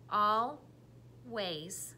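always said slowly, al and ways apart; chopped into syllables. Shown as a demonstration. Teacher chopping the word into syllables. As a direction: chop always into its beats. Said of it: "tell me the parts or the syllables".

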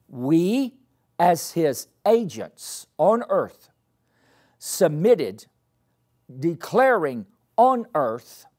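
loud, slow preaching at full force. Acted. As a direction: proclaim it to the whole church.

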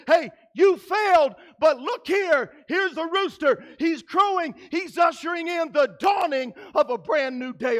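loud, high, ringing call to Peter; God calls out to Peter, loud and high. Retold or imitated. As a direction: shout the good news to the failed disciple. God voiced calling to Peter.

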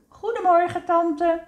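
put-on chorus it like polite children; greeting in unison. A narrator's reading. Woezel and Pip greet aunt, high sing-out greeting.

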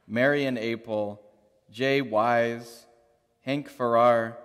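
ordinary delivery

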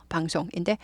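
ordinary talk, nothing marked

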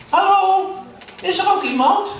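high, loud call into the dark. Acted. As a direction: call out alone in the box. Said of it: "en ze roept". (luid roepend in het donker)

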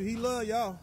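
ordinary talk

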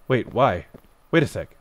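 normal talking voice

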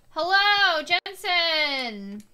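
high, loud cheery hello; loud greeting. Acted. Player greeting Jensen in the game, loud and cheery. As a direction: call out happily to a friend.

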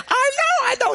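put-on high, whining kid's protest; whiny child. Nephew whining back at his uncle about homework. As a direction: whine it like a caught ten-year-old.

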